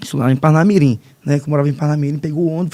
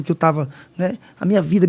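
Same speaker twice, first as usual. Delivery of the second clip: usual speaking voice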